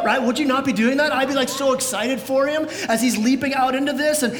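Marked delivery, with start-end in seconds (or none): none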